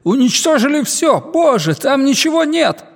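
high-pitched, horrified cry over the radio; panicked report. Acted. Major reporting Earth's destruction to flight control, panicked. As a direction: cry out in shock at total devastation.

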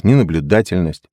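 plain reading voice

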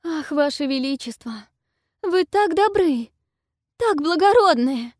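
high, loud gushing princess voice; exclaiming princess. Acted. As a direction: gush it out like a doting princess. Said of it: "воскликнула княгиня".

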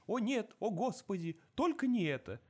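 high, whimpering mock plea; mock terrified pleading. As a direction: imitate a begging victim. Victims' pleading quoted.

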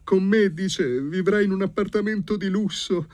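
very high, loud plaintive wailing; high-pitched tearful complaint; tearful young lady. A narrator's reading. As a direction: pour out the grievance in a high voice. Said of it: "piangeva la signorina".

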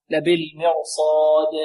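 slow لبالمرصاد with a clearly bounced final d; clear qalqalah. Shown as a demonstration. Teacher demonstrates clearer qalqalah at a stop, echoed dal in لبالمرصاد. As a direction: hold the long aa, then release د with an echo. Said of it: "والقلقلة تكون أبين".

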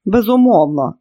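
loud, mildly scornful agreement; scoffing. A narrator's reading. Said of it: "хмикнула Крісті".

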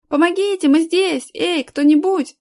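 high, loud cries for help; joining in shouts. A narrator's reading. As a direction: take up the call, pitched high and loud. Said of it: "подхватили мальчики". (высокие громкие крики о помощи)

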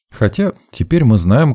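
usual speaking voice

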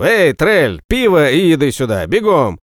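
loud, bossy yell at a servant. A narrator's reading. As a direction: holler it across the yard. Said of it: "заорал проходящему мимо холопу".

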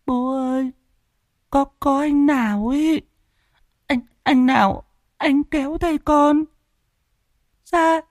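put-on a scared little boy whimpers to his dad; whimpering little boy. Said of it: "Thằng tít mếu máo". (giọng bé trai mếu máo, cao và nhỏ)

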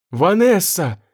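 high, pleading cry of a name; a plaintive squeal; plaintive shriek. A narrator's reading. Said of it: "раздался жалобный визг".

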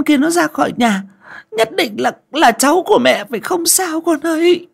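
put-on high, loud whimpering old mother's voice; a mother pleading through sniffles, pitched far up; high whining old woman's voice; sniffling old mother. A narrator's reading. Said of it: "bà Báu vẫn cứ ỉ ôi".

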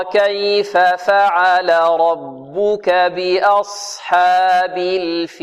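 slow, melodic tajweed chant; Quran recitation. Shown as a demonstration. Teacher leads pupils through the verse, slow Quran recitation. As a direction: recite with long held vowels, very slowly.

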